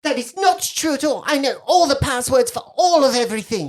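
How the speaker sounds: loud, high protest; indignant little girl. Acted. Girl to doubter of her passwords, indignant. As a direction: insist hotly like a contradicted child.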